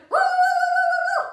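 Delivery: loud, high run of dog woos; howling dog. Acted. Storyteller as frightened dog in the bed, loud howling. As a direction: howl out a string of high woos. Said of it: "the dog said".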